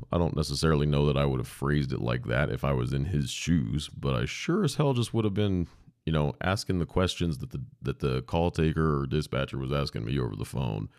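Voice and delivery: ordinary talk, nothing marked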